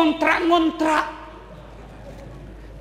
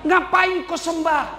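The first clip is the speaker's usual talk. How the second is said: Satan mocking; 6 semitones higher, slower than usual. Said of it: "Kata setan".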